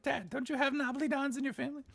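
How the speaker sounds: very high, put-on questioning voice; high-pitched mock protest; retold question to dad. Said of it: "I'm like".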